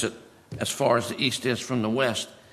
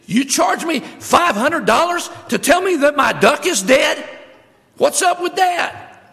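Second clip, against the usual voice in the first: much higher, shouting in disbelief; outraged lady; 7 dB louder, slower than usual. Acted. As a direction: shriek it like a customer robbed at the counter. Lady outraged at the vet bill.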